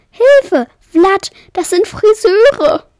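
high, loud, panicked cry for help; horrified screaming. A narrator's reading. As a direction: shriek to a friend to save you. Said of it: "schrie sie entsetzt".

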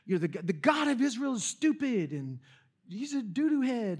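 mocking Goliath. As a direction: sneer it like a schoolyard bully.

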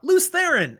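high, loud shout of a name; yelling out. Acted. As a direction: yell it out to turn someone's head. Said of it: "So he yells out".

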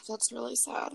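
soft unvoiced mock sigh; whispered mock pity. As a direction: breathe it out in fake pity.

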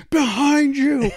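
high, drawn-out calling out; hammed-up alarmed cry; mock urgent warning. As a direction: stretch it out high like a dramatic warning. Retold Joey's warning.

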